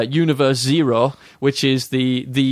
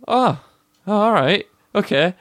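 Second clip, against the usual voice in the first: squeaky, much higher whiny agreement; mock timid heroes; 7 semitones higher than usual. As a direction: cave in meekly in a high little voice. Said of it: "And they go".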